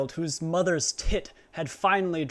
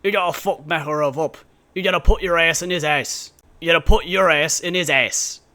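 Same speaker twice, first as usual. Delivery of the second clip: a hint of a British Captain Price accent, louder; Captain Price impression; 8 dB louder than usual. A vlogger mocking the briefing in a loosely done British Price voice, louder. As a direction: give it a lightly put-on British Price bark. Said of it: "Captain Price would pull up and be like".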